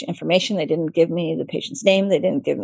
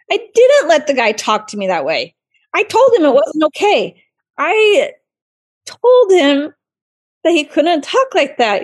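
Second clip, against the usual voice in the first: higher, emphatic self-defense; indignant protest; 8 semitones higher, 4 dB louder than usual. Retold or imitated. Surgeon defending herself to fellow residents, indignant. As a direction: push back hard, punching TOLD.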